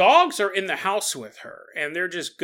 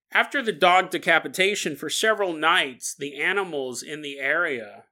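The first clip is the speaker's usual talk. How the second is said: ordinary delivery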